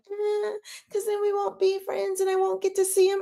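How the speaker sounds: high, monotone mocking whine; mocking high-pitched excuse; whiny drama heroine. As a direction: mimic a whining girl way up high. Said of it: "She's all like".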